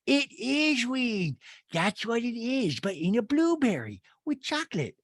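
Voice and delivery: high, slow, drawn-out mock voice; hammed-up Rinna explaining the edible; Rinna impression. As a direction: stretch it out high and matter-of-fact. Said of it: "and Rinna goes".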